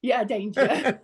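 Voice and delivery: laughing while agreeing to the word danger. Real, not acted. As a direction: chuckle through the words, amused.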